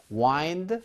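"wind" as wynd; long-i wind. Shown as a demonstration. Teacher recites the verb forms for meandering, long-i "wind". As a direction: say "wind" to rhyme with find. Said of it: "이렇게 여러분들이 아셔야".

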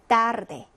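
tarde opening on a tense unaspirated t, like Korean ㄸ; hard Spanish t. Shown as a demonstration. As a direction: say tarde as 따르데, no puff on the t. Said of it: "쌍디귿 발음입니다".

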